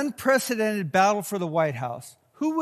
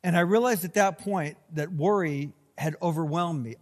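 ordinary talk

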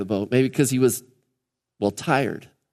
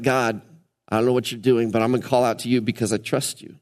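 quiet trusting prayer; 7 semitones lower, 3 dB quieter than usual. Retold or imitated. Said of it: "you're like".

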